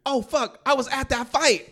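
high-pitched, loud amazed outburst; surprised, animated. Retold or imitated. Telling his friend he saw that fight, animated surprise. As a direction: burst out with excited recognition. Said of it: "so surprised and animated about it".

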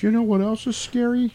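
high-pitched girl imitation; mock little girl. Girlfriend thanking Marty by the murder house, high girl voice. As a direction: play a timid child, hammed up.

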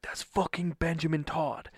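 restrained, urgent disbelief at spotting a star; starstruck hushed news. Retold or imitated. Husband tells wife he spotted his idol, contained excitement. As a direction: tell it urgently but keep it contained.

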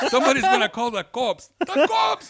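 very high, hammed-up scolding in a thick accent; Ludo's thick accent. Woman warning giggling friends about police, thick accent. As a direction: imitate a thick-accented friend, climbing to a squeal. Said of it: "has this really thick accent".

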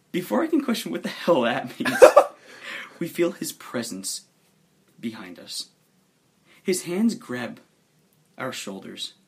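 ordinary talk

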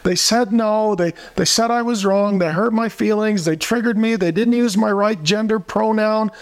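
high, whining mockery of the wolves; mock their complaints in a whiny voice; whiny mock victim. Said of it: "the wolves were".

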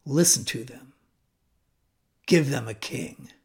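dripping sarcasm. Acted. The Lord answering Samuel about the people, dripping sarcasm. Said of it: "in a tone of voice dripping with sarcasm".

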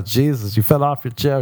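usual speaking voice

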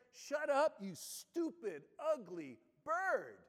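mock angry shout at the parrot; a repairman losing his temper, hammed-up; retold yell. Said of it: "yelled at the parrot".

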